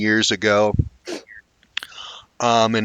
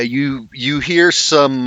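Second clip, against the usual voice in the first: ordinary talk, nothing marked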